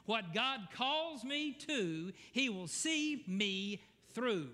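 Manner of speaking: slow, stressed call for them to repeat; congregation response. Shown as a demonstration. Pastor leading the congregation to repeat, slowly. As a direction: lead the room in saying it. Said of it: "Say that with me".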